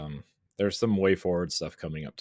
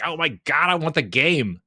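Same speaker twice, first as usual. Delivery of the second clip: excited; 9 semitones higher, 7 dB louder than usual. Retold or imitated. Retold own excited reaction.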